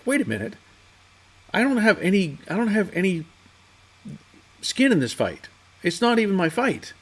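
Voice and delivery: sudden realization. Retold or imitated. Realizing in bed after dream.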